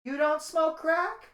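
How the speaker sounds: slow, high, casually puzzled question; calm casual surprise. Retold or imitated. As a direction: ask like it's the most normal thing. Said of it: "And calmly, this bitch says to me".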